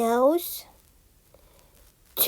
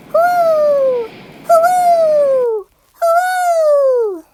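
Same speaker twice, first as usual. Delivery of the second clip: high, loud whooshing wind howl; hooting blizzard wind; 13 semitones higher, 5 dB louder, much slower than usual. A narrator's reading. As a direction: howl like a swirling snowstorm. Howling snowstorm wind imitated.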